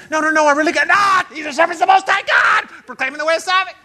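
high, loud, insistent hollering; crying out. Acted. Heckler interrupting a workplace talk, crying out loudly. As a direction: cut in and holler over them. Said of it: "they're crying out".

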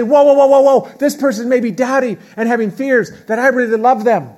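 catching yourself mid-argument, loud and urgent; urgent self-talk. Pretend. Telling yourself to stop in an argument, loud and urgent. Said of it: "be sympathetic to that and say".

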